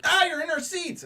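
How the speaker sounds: high-pitched indignant whine; mock outraged complaint. Moviegoer finding people in his seats, mock outrage. Retold complaint of seat claimants.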